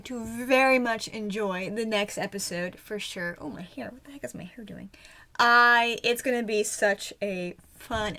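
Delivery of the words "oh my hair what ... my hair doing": soft embarrassed whisper; whispered aside. Real, not acted. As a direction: mutter it under your breath. Notices her hair on camera.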